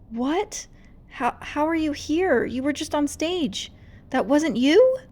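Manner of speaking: high, rising in disbelief; stunned to see them here; astonished. Acted. Rumi shocked by real friends.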